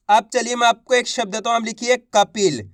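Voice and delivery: normal talking voice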